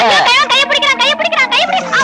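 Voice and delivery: shrieking for help. Acted. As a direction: scream it out so the whole village hears. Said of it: "கத்தாத".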